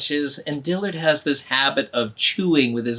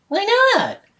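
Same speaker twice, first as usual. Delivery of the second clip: very high, loud surprised cry; surprised boy; 7 dB louder than usual. Acted. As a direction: jolt up in shock.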